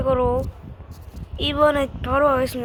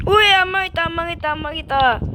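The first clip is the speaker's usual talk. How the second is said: loud happy cheer; relieved cheer; 5 semitones higher, 5 dB louder, faster than usual. Real, not acted. Near fail then saved.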